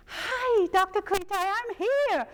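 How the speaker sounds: very high, cheery greeting; jolly Santa visitor. Retold or imitated. The chubby stranger greets the doctor, very high and jolly. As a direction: chirp it like a little Santa at the door. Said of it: "looked like a miniature Santa Claus".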